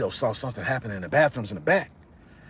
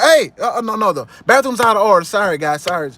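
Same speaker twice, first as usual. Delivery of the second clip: higher, louder, fast flustered excuse; nervous lie; 8 semitones higher, 8 dB louder than usual. Retold or imitated. A thug blocking the bathroom, loud flustered excuse. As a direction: stammer out a hasty lie. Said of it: "The guy was like".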